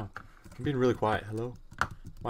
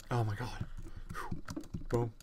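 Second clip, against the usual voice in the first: ordinary delivery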